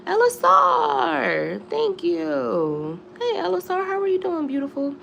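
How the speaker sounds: high, sing-song greeting; sweet pet voice. Real, not acted. Greeting a fan from chat in a sweet high coo.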